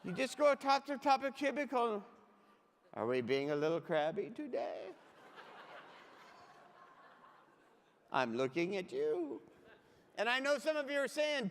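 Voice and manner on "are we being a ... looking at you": low mock-menacing puppet voice; teasing crab puppet. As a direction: play a crab peering over a cubicle, pitched well down. Plays cubicle crab teasing grumpy coworkers.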